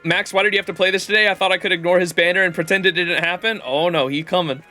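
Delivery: ordinary talk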